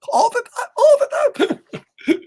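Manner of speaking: high squealing laugh through the words; laughing tease. Real, not acted. Teasing Miss Simone, squealing with laughter. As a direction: giggle it out, climbing high.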